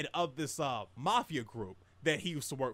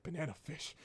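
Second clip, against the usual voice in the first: mumbled; 11 dB quieter than usual. Retold or imitated. Brainwashed veteran repeats his only words, mumbling. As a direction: say it faintly, as if brainwashed. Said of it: "mumbling those words and whatnot".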